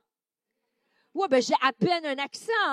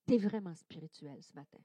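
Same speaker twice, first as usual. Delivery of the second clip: softly modelled; 9 semitones lower, 7 dB quieter than usual. Shown as a demonstration. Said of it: "Donc, dis à ton voisin".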